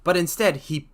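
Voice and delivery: usual speaking voice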